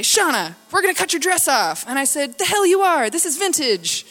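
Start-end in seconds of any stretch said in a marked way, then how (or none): 0.0-1.8 loud, higher urgent announcement; frantic staff imitation. Frantic hospital staff address patient. 2.4-4.1 loud, high mock outrage; indignant retort. Indignant retort to dress cutting.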